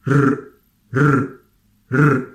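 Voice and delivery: R as a bare, strongly rolled trill; stressed trill. Shown as a demonstration. Teacher isolates the letter Ra, stressed rolled trill. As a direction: roll a lone R hard on the tongue tip. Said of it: "if you try to stress on the letter Ra".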